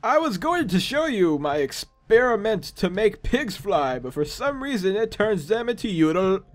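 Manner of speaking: lilting, sing-song scientist voice; put-on eccentric doctor; Doctor Graham. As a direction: explain the experiment with theatrical swoops. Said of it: "I forget what voice I gave him".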